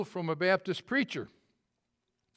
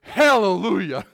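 much louder, drawn-out joyful Hallelujah; excited yell; 4 semitones higher, 13 dB louder, slower than usual. Retold or imitated. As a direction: burst out in relief and joy. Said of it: "he was so excited he yelled".

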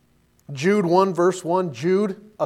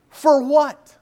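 louder, higher disbelieving challenge; incredulous retort; 8 semitones higher, 5 dB louder than usual. Real, not acted. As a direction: fire back in disbelief, high and loud. Preacher retorts to self-esteem claim.

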